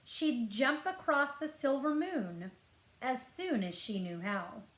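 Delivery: ordinary delivery, nothing marked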